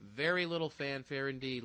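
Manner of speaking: ordinary delivery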